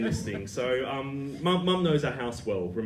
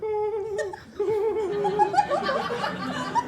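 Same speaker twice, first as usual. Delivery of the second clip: a high, shy giggle imitating Mum; shy laughter. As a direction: giggle shyly and embarrassed, pitched way up. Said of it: "this shy laughter".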